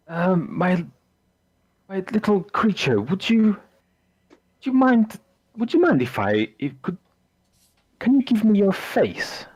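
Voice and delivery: high breathy fascination with her face; eager creepy abbot. Acted. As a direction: coo over her like a prize. GM voices abbot, excited creepy.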